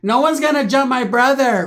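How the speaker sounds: high, loud, slow defiant shout; hammed-up protective challenge; mock defiant. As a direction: draw out the challenge loudly. Acted brother storming out to defend.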